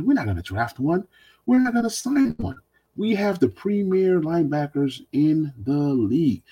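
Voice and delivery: high-pitched mock boast of team bosses; sarcastic mimicry. Host quotes the coaching staff on linebackers, mockingly. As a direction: quote them with mocking grandeur, slowing at the end. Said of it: "Because Ron Rivera and co said".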